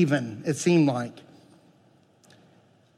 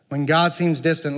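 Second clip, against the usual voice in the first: ordinary talk, nothing marked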